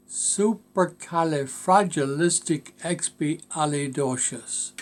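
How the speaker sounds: Supercalifragilisticexpialidocious drawn out slowly, part by part; Supercalifragilisticexpialidocious said very slowly, each syllable separated; slow pronunciation demo. Shown as a demonstration. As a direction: pronounce Supercalifragilisticexpialidocious slowly for a learner. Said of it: "to pronounce and learn".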